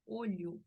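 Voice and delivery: "olho" ending in an oo sound, "OH-lyoo"; final O as U. Shown as a demonstration. Teacher shows Brazilian habit, "olho" ending in U. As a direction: turn the last vowel of "olho" into U. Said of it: "dice con sonido de U".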